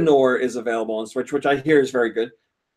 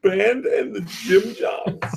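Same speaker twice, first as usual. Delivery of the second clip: slow, higher mock of the song name; drawn out, high bleating voice; goat voice; 6 semitones higher, much slower than usual.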